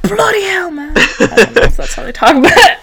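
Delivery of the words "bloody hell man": high-pitched mock British exclamation; badly imitated British voice; bad British accent. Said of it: "I do a really bad British accent".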